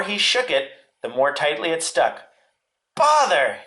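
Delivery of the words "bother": high, falling cry of annoyance; Pooh's exclamation. A narrator's reading. Pooh stuck inside the jar, high exasperated cry. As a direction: exclaim it high, dropping away. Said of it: "inside the jar".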